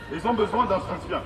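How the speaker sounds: ordinary delivery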